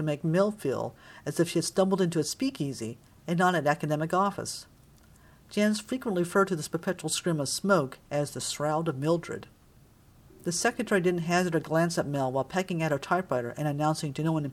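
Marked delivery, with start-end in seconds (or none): none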